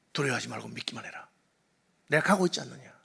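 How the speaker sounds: hushed, breathy reassurance; soft voice of Jesus encouraging; tender whisper. Acted. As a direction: whisper it like comforting a frightened father. Jesus gently reassures the ruler.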